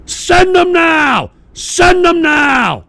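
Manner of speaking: high, slow, bellowing shout; roaring at the screen; furious shout. Real, not acted. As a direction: scream the demand, drawn out. Ranting demand at Putin.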